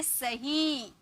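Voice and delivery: सही said slowly and softly, with the ही held long; drawn-out ही. Shown as a demonstration. Teacher demonstrates the long ई in सही for the class. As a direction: stretch out the ही in सही. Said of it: "ही को थोड़ा टान ले रहे हैं ना".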